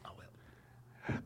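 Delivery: unvoiced whispered aside. Real, not acted. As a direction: whisper it under breath.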